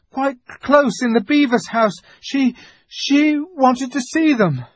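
put-on high, frightened boy's explanation; nervous pleading, faltering at the end; trembling with fear. A narrator's reading. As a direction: blurt it out in a high, scared voice. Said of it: "trembling so that he could hardly speak".

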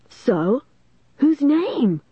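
high-pitched, impatient challenge; insistent question leaping up in pitch; demanding. A narrator's reading. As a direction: press hard for the answer. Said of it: "Lanny demanded".

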